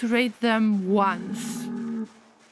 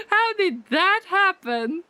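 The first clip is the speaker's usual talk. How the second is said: slow, high, laughing wonder; laughing disbelief; 8 semitones higher than usual. Real, not acted. Streamer baffled by the cow, laughing. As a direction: ask it through laughter.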